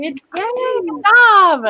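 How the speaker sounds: high, drawn-out cheer; long celebratory whoop of praise; congratulatory cheer. Real, not acted. Cheering a writer's word count.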